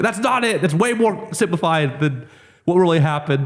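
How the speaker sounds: high, exasperated protest, slowing on the middle; mock indignant retort. Fighter answering the troll's jab, mock indignant. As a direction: correct him like he's missed the point.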